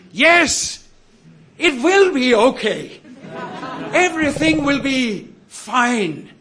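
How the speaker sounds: high-pitched re-enacted triumphant cry; exultant shout. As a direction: call it out high, as if at the top of your voice. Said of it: "at the top of my voice, I s-, shouted".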